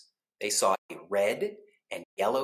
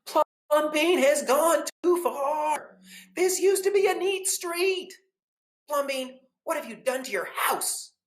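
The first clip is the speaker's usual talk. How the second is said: outraged shout; 6 dB louder than usual. Acted. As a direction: wail it in outrage. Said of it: "the people shouted".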